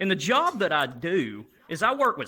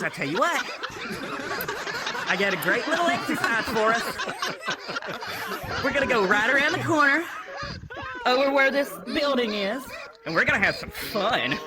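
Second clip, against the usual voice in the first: higher, sing-out camp invitation; flamboyant tour guide; 7 semitones higher, faster than usual. Retold or imitated. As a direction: imitate an excited, flamboyant tour guide rallying the group. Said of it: "this flaming homosexual".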